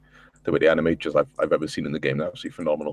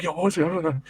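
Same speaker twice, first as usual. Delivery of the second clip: high-pitched mock panic; higher, anxious retelling; retold freaking out; 11 semitones higher, faster than usual. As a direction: act out nervous alarm to a friend. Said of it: "I was really freaking out".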